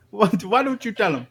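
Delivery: high put-on London accent; mock London accent. Host in mock Cockney hands the award over to cohost. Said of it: "It's always goes Jamaican".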